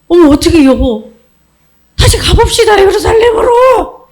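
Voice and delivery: frantic wife urging her husband back; panicked mother. Acted. Mother begging her husband to go back, panicked. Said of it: "얼마나 놀랬겠니?".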